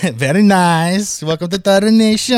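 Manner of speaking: Borat. Retold or imitated. Host drifting from French into a Borat accent. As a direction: ham up a Borat voice with a laugh. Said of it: "turned into Borat".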